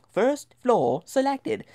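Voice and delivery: high, slow British announcement; British elevator voice. Retold or imitated. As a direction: mimic an automated British lift announcer. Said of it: "I don't know why it was British".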